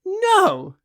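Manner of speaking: a very high, decided No; firm no. A narrator's reading. Woman refuses her suitor's plea, high decisive no. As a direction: shut him down in one word. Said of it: "she said with a very positive shake".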